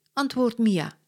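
usual speaking voice

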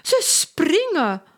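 crying out in delight; excited cry. A narrator's reading. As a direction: point them out eagerly. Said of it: "roept Lola".

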